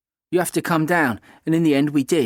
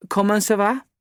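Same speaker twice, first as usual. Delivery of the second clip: put-on higher-pitched French accent; perfect French accent; 7 semitones higher than usual. A narrator's reading. Kat teasing the narrator among French teenagers, in a perfect French accent. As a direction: ask it in a polished French accent. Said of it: "in a perfect French accent".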